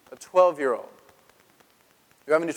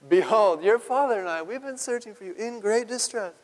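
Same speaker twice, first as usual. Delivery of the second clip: upset mother; 6 semitones higher than usual. Acted. Mary to the boy Jesus, high pleading. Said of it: "So Mary says to him".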